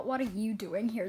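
ordinary delivery

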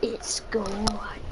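soft slow whisper. Pretend. Gamer teasing his viewers with a hushed slow whisper. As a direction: hush it like a secret reveal.

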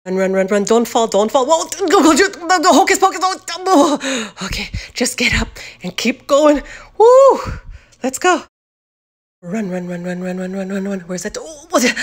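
loud frantic running; being chased. Acted. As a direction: shout while fleeing. Chased again.

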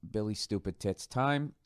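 ordinary talk, nothing marked

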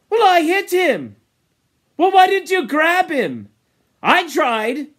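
high, loud argumentative shout; heated quarrel. Acted. Boys quarrelling over the escaped boar, heated shouting. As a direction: argue back hotly.

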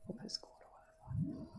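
whispered aside. Real, not acted. Muttering about Cole to herself, whispered. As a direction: breathe it to yourself.

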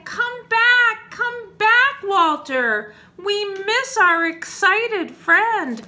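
loud, high crowd call; excited storybook shout. Acted. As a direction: call them back eagerly from afar. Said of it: "all the people saying".

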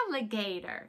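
gasp, then "Alligator" with playful surprise; mock surprise. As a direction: act amazed at the picture. Teacher reveals picture with gasp.

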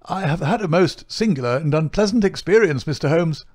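high, agitated voice of an outraged gentleman; flurried, indignant client. A narrator's reading. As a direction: speak up high, all pompous fluster. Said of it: "flurried, excited manner".

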